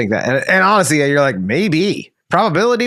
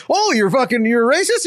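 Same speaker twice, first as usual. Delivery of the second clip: mock accuser; 9 semitones higher than usual. Mocking accusers in hypothetical.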